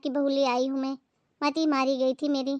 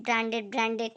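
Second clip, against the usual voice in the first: scornful mimicry; 5 semitones lower, 4 dB quieter than usual. Bahu mocks in-laws' brand obsession.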